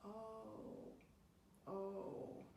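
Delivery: soft, drawn-out "oh"; softly repeating. Acted. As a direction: murmur it gently in sympathy. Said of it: "softly keeps repeating".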